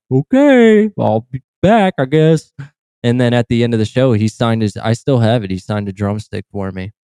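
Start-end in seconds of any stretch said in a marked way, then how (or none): 0.1-2.5 higher, sulky voice; mock dejected fan. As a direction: give up reluctantly. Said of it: "I'm like".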